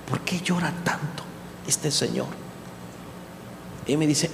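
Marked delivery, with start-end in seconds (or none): 0.1-2.3 softer, discreet asking; hushed aside to the son about the prisoner; hushed question. Retold or imitated. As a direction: ask it quietly so the old man won't hear. Asking quietly about weeping prisoner.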